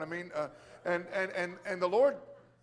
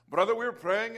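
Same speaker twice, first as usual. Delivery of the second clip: put-on higher, louder Indian-accent impersonation; imitated Indian accent; 4 semitones higher, 5 dB louder than usual. Church elder addressing the preacher, imitated Indian accent. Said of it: "I'll try not to do it".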